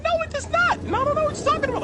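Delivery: very high squeaky fast denial; lying voice jumps way up high; Mickey Mouse voice. Retold or imitated.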